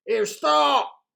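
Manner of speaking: loud, high call to halt; pirate chief calling a halt. A narrator's reading. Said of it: "called Hank Chief".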